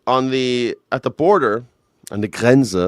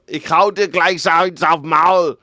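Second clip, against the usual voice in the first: louder, higher, slow playful threat; mock German threat; 5 semitones higher, 6 dB louder, slower than usual. Host repeating a German threat for laughs, loud and emphatic.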